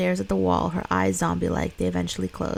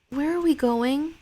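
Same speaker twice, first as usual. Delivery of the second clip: put-on higher little boy voice, pleading; young boy; 8 semitones higher than usual. Sick son asking his mother for food, small higher boy voice. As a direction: play the son, small and needy.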